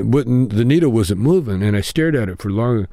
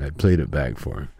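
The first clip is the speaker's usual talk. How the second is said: ordinary talk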